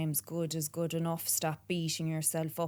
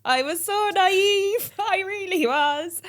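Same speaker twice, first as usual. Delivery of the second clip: high and loud, laughing through the words; real laughter; 12 semitones higher, 13 dB louder than usual. Real, not acted. To the host, laughing at herself. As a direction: laugh at how naive you were. Laughing at her own naivety.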